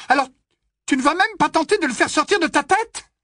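worried friend arguing, high and loud; upset Hermione. Acted. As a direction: plead and scold at once.

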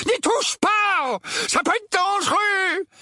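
high, drawn-out warning yell; roaring. A narrator's reading. Uncle Vernon warning his wife about danger, roaring. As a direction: roar it out in alarm. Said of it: "rugit l'oncle Vernon".

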